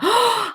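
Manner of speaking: high, voiced gasp; a short, sudden high gasp; sharp gasp. Real, not acted. Delighted by the drink.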